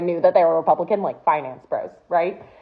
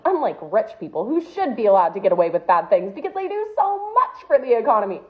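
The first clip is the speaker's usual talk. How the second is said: ordinary talk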